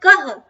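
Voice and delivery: कह with a full, loud breathy h after क; full visarga demo. Shown as a demonstration. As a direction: say कह with the h fully released. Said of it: "हम विसर्ग कैसे प्रयोग करते हैं?".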